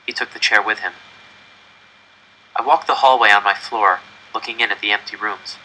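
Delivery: ordinary delivery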